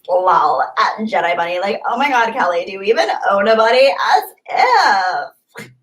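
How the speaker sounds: put-on Valley Girl lilt with swooping pitch; exaggerated Valley Girl attitude. Shown as a demonstration. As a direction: toss it off like an appalled Valley Girl. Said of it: "that wasn't a bad Valley Girl impression".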